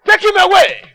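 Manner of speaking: loud royal command; commanding order. Acted. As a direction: bark the order to the guards. King orders guards.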